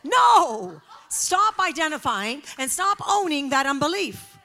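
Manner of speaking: very loud "No!" then loud command; shouted rebuke. Real, not acted. Preacher rebuking believers who own unbelief, loud shout. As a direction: shout them out of it.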